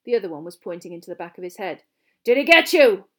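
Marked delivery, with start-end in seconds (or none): 2.2-3.1 loud, forceful growled question; gravelly growl. A narrator's reading. Teacher checking on Harry after an attack, growling. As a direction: growl it out at full volume. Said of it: "Moody growled. His voice was low and gravelly".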